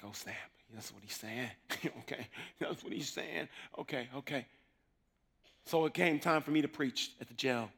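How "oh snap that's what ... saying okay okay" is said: muttering to himself. Retold or imitated. Muttering while taking notes.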